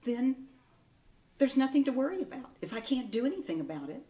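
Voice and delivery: ordinary talk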